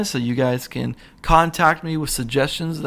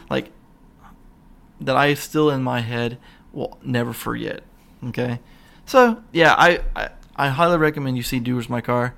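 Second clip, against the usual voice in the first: usual speaking voice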